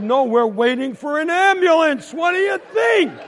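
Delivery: rising to a high, loud yell; sarcastic outburst. Acted. Kid answering brother's dumb question, sarcastic shout. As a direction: snap back, building in exasperation.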